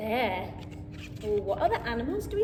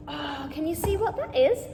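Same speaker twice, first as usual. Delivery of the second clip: usual speaking voice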